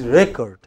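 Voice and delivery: REcord, first syllable strong; weight on RE, light "cord" in "record"; noun stress. Shown as a demonstration. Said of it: "when it is noun".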